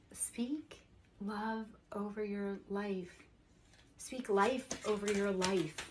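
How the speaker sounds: slow, breathy whisper of a blessing; soft whisper. Acted. Reader whispering a blessing to her viewers, slow and hushed. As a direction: whisper it slowly, like a secret blessing.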